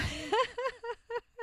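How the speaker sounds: high, real burst of laughter; cracking up laughing. Real, not acted. Podcaster amused by her own skit, cracking up. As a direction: laugh helplessly at your own act. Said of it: "I'm cracking myself up".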